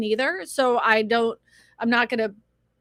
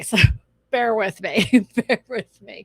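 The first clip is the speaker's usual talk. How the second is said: laughing; 5 dB louder than usual. Real, not acted. Nervous laugh flying solo.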